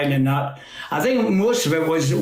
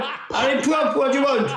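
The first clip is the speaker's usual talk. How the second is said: cheeky call-out; 9 semitones higher than usual. Retold or imitated. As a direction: call out to the Pope like an old mate. Retelling the Pope call, laughing.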